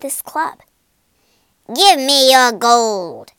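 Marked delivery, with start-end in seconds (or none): 1.7-3.3 loud, slow robber's demand; menacing shout. A narrator's reading. Robber jumps out demanding Pinchbeck's gold, loud and slow. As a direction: bark the threat. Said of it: "he shouted".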